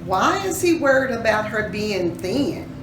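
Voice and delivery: usual speaking voice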